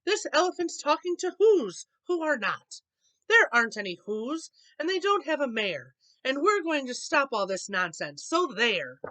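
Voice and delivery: loud, high jeering shout; monkeys shouting. A narrator's reading. Wickersham brothers jeering at Horton, loud high shout. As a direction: yell them down with scorn. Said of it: "came shouting".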